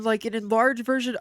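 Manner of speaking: usual speaking voice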